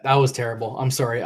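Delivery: usual speaking voice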